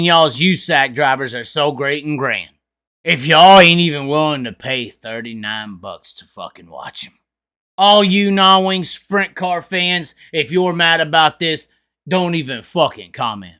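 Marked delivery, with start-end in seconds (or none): none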